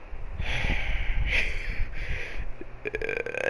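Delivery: long heavy breath out, shaky; drawn-out anxious exhale; long shaky sigh. Real, not acted. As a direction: let out a long trembling sigh. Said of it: "And I'm still panicking".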